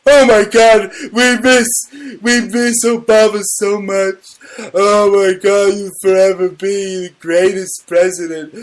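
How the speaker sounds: high, loud, hammed-up gushing over Obama; mock sobbing fan. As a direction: whine it like a heartbroken fan. Mocking liberals missing Obama.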